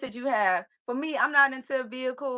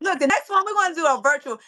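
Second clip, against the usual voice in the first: excited announcement; 6 semitones higher, 3 dB louder than usual. Real, not acted.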